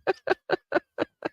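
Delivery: squeaky breathy laugh. Real, not acted. Laughing at the garbled clip.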